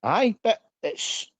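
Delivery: normal talking voice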